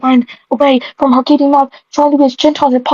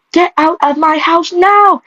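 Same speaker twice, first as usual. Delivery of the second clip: put-on drunken scream; 7 semitones higher, slower than usual. A narrator's reading. Drunk mother throws daughter out, screaming drunkenly. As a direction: stretch the order out slowly, pitched way up. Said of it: "she screamed drunkenly".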